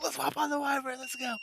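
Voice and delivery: high-pitched, giddy self-mockery; mock gung-ho. Player mocks own rash departure, high and gung-ho. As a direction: squeal it like a carefree adventurer.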